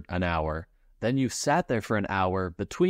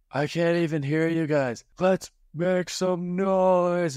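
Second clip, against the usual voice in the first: higher, slow, stretched-out hype call; imitating an overeager announcer demanding more noise; mock emcee yell; 8 semitones higher, slower than usual. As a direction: drag it out like a host milking the crowd. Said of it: "And they scream it".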